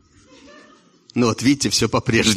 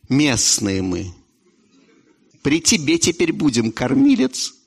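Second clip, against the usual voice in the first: lightly voiced servile old men; 2 semitones lower than usual. Locals answer president.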